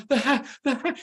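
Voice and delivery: high, breathless gasps through laughter; imitating laughing gasp. Mother-in-law on the train, imitated gasping through laughter. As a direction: squeak it out between laughs. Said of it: "She couldn't even say the hat".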